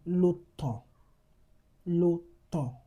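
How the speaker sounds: l'automne with nasal ending; French nasal example. Shown as a demonstration. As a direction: model l'automne twice. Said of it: "You have letters".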